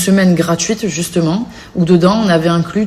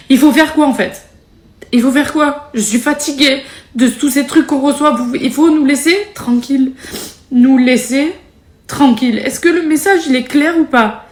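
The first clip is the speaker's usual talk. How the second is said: fed-up outburst; 8 semitones higher than usual. Real, not acted. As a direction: let the frustration boil over, voice raised high. Fed-up outburst at online critics. (ras-le-bol, exaspérée, voix qui monte)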